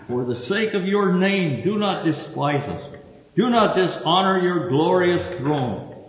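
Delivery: lifted preaching voice for a lament; scripture reading. Acted. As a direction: read it as an earnest plea to God. Reads Jeremiah's prayer aloud.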